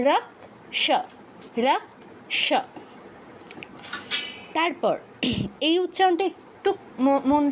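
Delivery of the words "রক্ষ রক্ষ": রক্ষ as "raksh", clear k then sh; Sanskrit way. Shown as a demonstration. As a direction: say রক্ষ with k and sh apart. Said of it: "উচ্চারণটা কি?". (সংস্কৃত উচ্চারণে রক্ষ)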